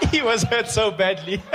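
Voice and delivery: loud words pushed out through laughter; laughing. Real, not acted. Comic laughs hard telling of a man falling, laughing. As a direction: laugh your way through the line.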